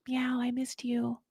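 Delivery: soft unvoiced whisper to the cat. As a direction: breathe it to the cat like a secret.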